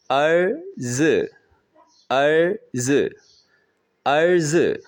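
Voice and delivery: अर्ज़ with a high rising first syllable, toneless ending; Chinese tone demo. Shown as a demonstration. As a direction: lift the अर of अर्ज़ high, let the ज़ drop away. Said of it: "इस अर में second tone है". (अर्ज़ में अर ऊँचा उठे, ज़ हल्का गिरे)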